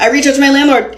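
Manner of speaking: normal talking voice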